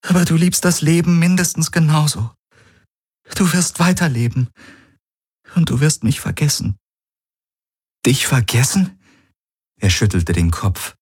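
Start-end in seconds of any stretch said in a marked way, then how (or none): 0.0-6.8 high-pitched, insistent pleading; anguished woman, pleading. Acted. Dying woman urging her lover to live on, pleading. 8.0-9.0 wounded, higher rising question; hurt, almost offended. Acted. Man answering his partner's suggestion, hurt and offended. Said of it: "verletzt, fast beleidigt".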